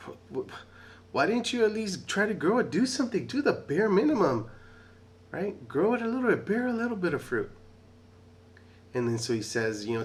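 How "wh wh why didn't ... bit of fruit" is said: high, loud scolding rant; the master scolding the servant who buried the silver, high and loud. Retold or imitated. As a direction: scold him hard, pitch way up. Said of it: "scolding him".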